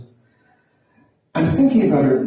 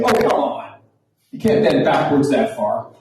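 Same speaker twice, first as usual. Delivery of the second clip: exasperated retort. Retold or imitated. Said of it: "I wanted to say".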